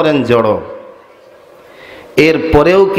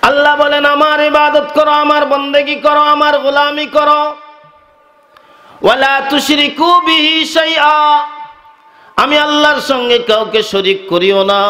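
high-pitched, ringing monotone sermon chant; full-force preaching; 11 semitones higher than usual. Real, not acted. Preacher delivers God's command to the crowd, high impassioned preaching. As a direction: proclaim it on one high sustained note.

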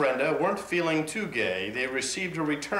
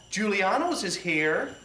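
higher-pitched festive announcement; cheerful announcement; 6 semitones higher than usual. A narrator's reading. Monica announcing to all with Christmas cheer, a raised announcing call. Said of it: "announcing to all".

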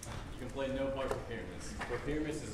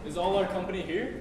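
loud call; 6 semitones higher, 8 dB louder than usual. Acted. Quince calling the troupe.

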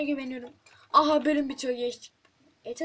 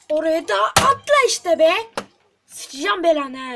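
loud angry outburst, slowing on the curse; frustrated yell; 4 semitones higher, 11 dB louder than usual. Real, not acted. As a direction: shout at the game in fury. Frustrated yelling at character.

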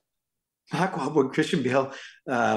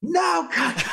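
very high, exasperated scolding; high-pitched mock scream; screaming director; 4 dB louder than usual. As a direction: imitate a director losing patience, pitched way up. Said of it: "he's screaming over the thing".

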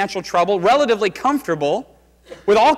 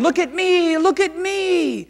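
fading echo; 12 semitones higher than usual. Acted. Faint echoes of the nine.

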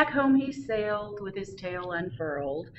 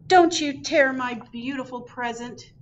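higher and louder desperate cry; cried out; 6 semitones higher, 6 dB louder than usual. Acted. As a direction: cry out to save the scarf. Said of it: "he cried".

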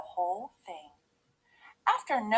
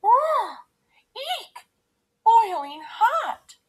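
high yelps, then a slow loud cry; pained yelp; 13 semitones higher, 8 dB louder, slower than usual. A narrator's reading. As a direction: yelp as if your mouth is scalded. Burns mouth on hot porridge.

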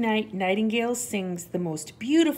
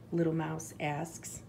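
usual speaking voice

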